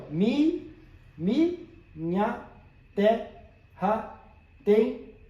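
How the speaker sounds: Mi, mi, minha terra tem split into spoken syllables, pitch up on the first; counting syllables. Shown as a demonstration. Teacher counting poetic syllables aloud for the class. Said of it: "Então vamos contar aqui".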